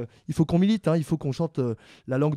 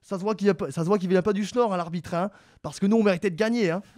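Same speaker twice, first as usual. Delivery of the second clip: higher, hammed-up northern French accent; bad Ch'ti accent; 3 semitones higher, 3 dB louder than usual. Debater as Lens fans after a match, clumsy Ch'ti accent. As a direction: badly imitate a grumbling Lens supporter's accent. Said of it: "l'imitation des ch'tis était vraiment horrible".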